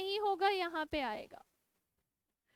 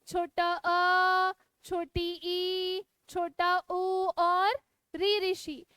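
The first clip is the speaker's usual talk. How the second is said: drawn-out vowels, very slow; slow dictation; much slower than usual. Shown as a demonstration. Teacher dictating vowel examples to her class, very slowly. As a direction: stretch each vowel out for copying. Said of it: "उदाहरण लिखिएगा मेरे साथ".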